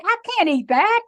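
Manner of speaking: high, loud, emphatic refusal; emphatic retold outburst. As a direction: blurt it out, pitched right up. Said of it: "I said it so emphatic".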